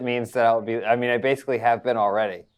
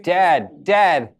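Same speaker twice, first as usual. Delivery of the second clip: loud, higher, urgent call; 6 semitones higher, 7 dB louder than usual. Acted. Son arrives at treehouse looking for dad, calling out. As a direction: call out to find your father.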